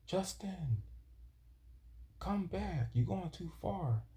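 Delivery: lightly mocked-up girl calling from the shore; retold yell. Girl on the beach calling Justin back, mock high yell. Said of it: "she was yelling at me saying".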